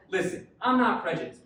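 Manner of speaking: usual speaking voice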